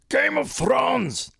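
very high, slow, drawn-out silly voice; funny remote-command voice. Retold or imitated. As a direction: squeal each word slowly to fool the remote. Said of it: "to outsmart the, the remote".

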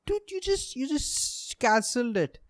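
high, squeaky exasperated "dude"; exasperated disbelief. Retold or imitated. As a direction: voice the bottled-up frustration. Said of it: "I'm like".

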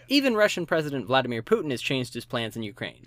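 ordinary delivery

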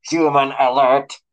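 loud, slow mechanical alarm; robot alarm. A narrator's reading. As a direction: blare it like a siren robot. Enraged sentinel raising alarm.

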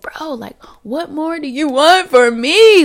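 high, loud, slow complaint; exasperated plea. Retold or imitated. To the universe, a loud exasperated plea. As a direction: cry out in frustration.

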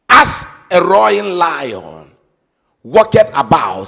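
scripture proclaimed at full voice; booming scripture reading. Acted. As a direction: declare the verse to the hall.

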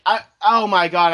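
ordinary delivery

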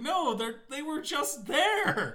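high, slow protest; exasperated Brian. Retold or imitated. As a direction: protest the bushes were already there.

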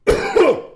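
loud, pointed cough; staged cough. Pretend. Sports host coughing at a rival coach's nonsense, staged cough. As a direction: cough loudly to make a point. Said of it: "I got a cough".

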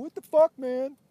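loud high mock outburst of the riled-up driver; imitating angry driver. Retelling the UPS driver yelling at him, loud mock outrage. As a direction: throw your hands up and yell it. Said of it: "He had the hands up".

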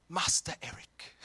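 hushed, reverent mock whisper. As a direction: breathe the title like a secret wish.

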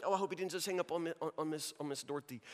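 soft, quick muttered thought, fading away; muttered aside. Retold or imitated. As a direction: say it under your breath. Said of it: "I just sort of think".

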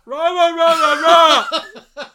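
very loud, high mock bellowing; imitating a loud talker. Diner mimicking the loud guy a few booths down, bellowing. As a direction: blare it like an obnoxious loudmouth. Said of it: "This one guy was so loud".

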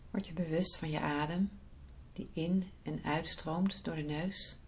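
soft, unhurried meditation voice; guided meditation. Acted. Leads a guided visualisation.

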